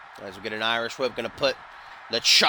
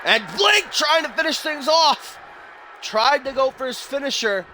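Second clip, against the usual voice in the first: high, rising hype; excited commentary; 10 semitones higher than usual. Real, not acted. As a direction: sell the finisher like a big moment. Commentator hyped as finisher comes.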